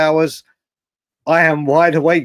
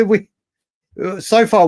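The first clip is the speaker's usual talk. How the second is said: ordinary talk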